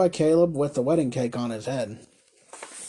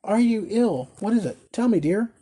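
high, concerned questioning; anxious exclamation; 6 semitones higher, 4 dB louder than usual. A narrator's reading. Husband questions the wife in his arms, anxiously. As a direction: press her anxiously, pitch raised. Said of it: "exclaimed the carrier".

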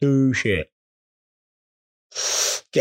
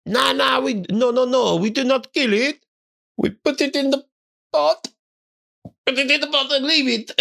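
higher mock chef voice, insisting; hammed-up higher chef voice; imitating the chef; 10 semitones higher than usual. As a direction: send up the chef explaining he won't kill it. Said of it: "He was like".

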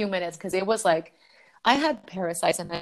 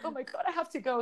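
near-tears panic; 8 semitones higher, 8 dB quieter, faster than usual. Retold or imitated. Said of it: "So I start crying".

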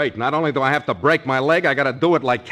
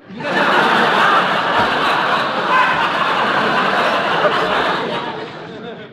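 long breathy laugh, louder; 7 semitones higher, 4 dB louder than usual. Real, not acted. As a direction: break up laughing mid-line. [name] laughs mid-sentence.

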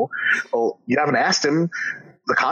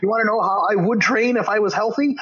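much higher, heated exasperation; exasperated rant; 10 semitones higher than usual. Real, not acted. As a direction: vent at the clueless commenters. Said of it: "exasperated rant at commenters".

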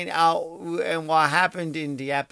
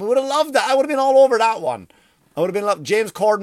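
much higher, louder, faster glee; high, loud and fast gleeful excitement; gleeful enthusiasm; 8 semitones higher, 6 dB louder, faster than usual. Real, not acted. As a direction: burst with delight at the idea. Excited about gossip.

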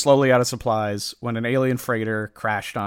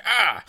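a sudden high yelp, much higher, as if hit in the face; startled yelp; 9 semitones higher than usual. Retold or imitated. The second comic page, Superman startled by the attacking alien, high yelp. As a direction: yelp like a hero caught off guard by the alien's mouth.

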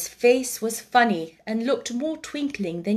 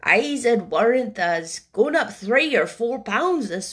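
put-on slow old gardener in Yorkshire speech; Ben Weatherstaff, Yorkshire old man; 3 dB louder, slower than usual. Old Ben answering the boy, slow Yorkshire voice. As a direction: take your time as an old Yorkshireman.

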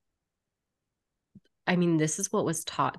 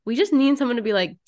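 usual speaking voice

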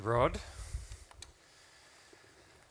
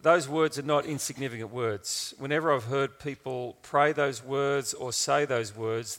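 ordinary delivery

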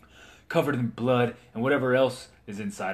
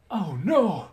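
alarmed cry; 10 semitones higher, 4 dB louder than usual. Acted. Knight glowing brightly.

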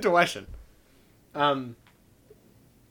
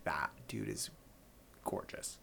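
hushed inner voice; 10 semitones lower, 20 dB quieter, slower than usual. Retold or imitated. Quoted inner thought, hushed.

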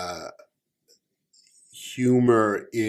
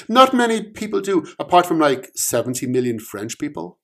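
smug bluff; 7 semitones higher, 4 dB louder, faster than usual. Acted. Omen smug.

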